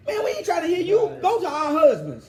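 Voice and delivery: high, loud mocking women's voice telling the prophet off; defiant women. Retelling how the women brushed off Yirmiyahu, loud high mock. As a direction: play the scornful women shouting back at him. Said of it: "They said".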